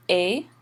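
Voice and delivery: "a" as one open vowel sound, S and T silent; French est pronunciation. Shown as a demonstration. Teacher showing how est sounds, "a" with silent S and T. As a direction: say "a" like English "ay". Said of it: "and that's pronounced".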